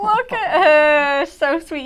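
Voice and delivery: gushing coo. Real, not acted. Host cooing to co-host over the little witch, high and slow. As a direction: melt over something adorable.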